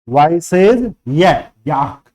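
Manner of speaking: ordinary delivery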